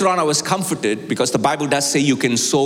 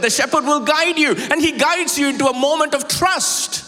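much higher, ringing preacher's call to the flock; full-force preaching; 11 semitones higher than usual. Acted. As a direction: lift it up and drive it home to the church.

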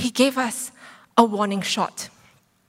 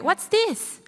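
puzzled question; 9 semitones higher than usual. Retold or imitated. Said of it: "And I'm like".

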